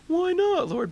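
very high-pitched, loud whine; baffled protest. Acted. Disciple questions Jesus's order of silence, high baffled protest. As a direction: squeak the question like a puzzled disciple.

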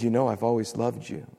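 gentle, hushed reassurance from God; tender God. Pretend. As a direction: speak like a father holding a child. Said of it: "Then God takes us up in His arms and He says".

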